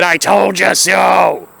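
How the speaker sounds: slow, smug drawn-out told you so; gloating. Acted. To viewers after being proven right, slow smug gloat. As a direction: savour every word.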